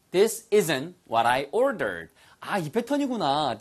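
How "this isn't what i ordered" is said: very slow, every word clear; this isn't what I ordered, drawn out for repeating; slow model sentence. Shown as a demonstration. Teacher modelling the sentence slowly for learners. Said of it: "ordered 됐죠?".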